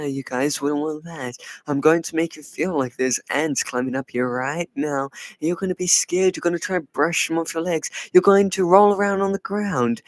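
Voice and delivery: usual speaking voice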